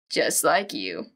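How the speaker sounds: creepy low murmur; low ominous echo. Acted. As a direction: drop it low and sinister.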